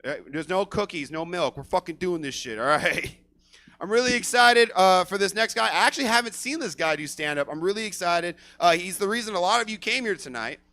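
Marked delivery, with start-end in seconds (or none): none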